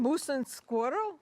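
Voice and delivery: put-on slow Russian villain accent. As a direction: drawl it like Boris Badenov. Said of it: "Okay, Boris".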